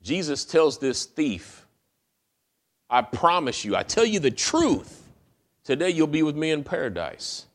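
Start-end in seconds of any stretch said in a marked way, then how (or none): 2.9-7.5 emphatic promise. Acted. Jesus promise repeated emphatically.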